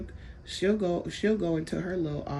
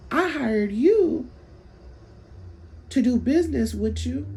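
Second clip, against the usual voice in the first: raised, much higher, louder angry comeback; heated retort; 7 semitones higher, 4 dB louder than usual. Retold or imitated. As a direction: throw it back at her, shouting. Retold heated argument with counselor.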